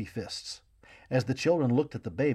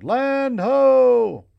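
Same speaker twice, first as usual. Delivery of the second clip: very loud, high call from the mast; sailor's call; 13 semitones higher, 13 dB louder than usual. A narrator's reading. Sailor on the mast calls to the ship, loud long shout. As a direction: bellow it down from the masthead. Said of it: "a sailor away up on the mast shouted".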